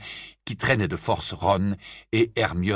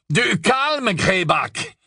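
sharp call; 10 semitones higher, much slower than usual. A narrator's reading. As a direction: call out to rein him in. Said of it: "lança Scabior".

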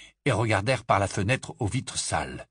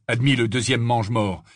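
ordinary delivery

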